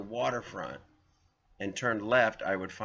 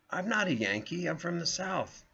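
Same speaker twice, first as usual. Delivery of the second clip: mock protest; 8 semitones higher than usual. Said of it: "And I'm like".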